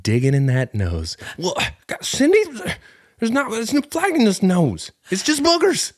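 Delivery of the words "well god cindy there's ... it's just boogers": squeaky high put-on wife voice, exasperated; high mock Cindy voice. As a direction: play a whiny high-pitched mom, fed up. Impersonating Cindy, high pitched.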